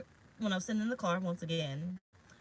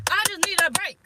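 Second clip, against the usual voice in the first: louder and higher, fed up; exasperated outburst; 8 semitones higher, 8 dB louder than usual. Real, not acted. Reader, worn out mid-reading, bursts out exasperated. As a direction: blurt it out, fed up.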